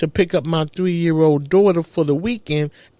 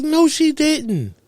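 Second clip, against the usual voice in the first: high, slow "No, she didn't"; stunned and incredulous; disbelief; 5 semitones higher than usual. Retold or imitated. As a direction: draw it out in shocked disbelief. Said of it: "I'm like".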